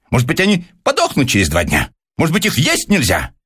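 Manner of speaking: high agitated spiteful rant; angry rant. A narrator's reading. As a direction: Persikov angrily complains to Pyotr Stepanovich. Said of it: "заговорил Персиков злобно". (злобно, высоким взволнованным голосом)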